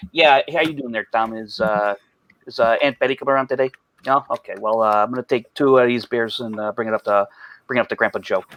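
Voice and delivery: imitating an uncle's thick Chicago accent; low-key, casual old-school Chicago talk; heavy Chicago accent. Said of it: "Chicago accent".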